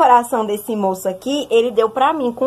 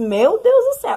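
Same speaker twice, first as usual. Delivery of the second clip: imitating fervent prayer, much higher pitch; 12 semitones higher than usual. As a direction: cry out to God, acted in retelling. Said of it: "Um menino fervoroso na oração". (oração fervorosa, imitada)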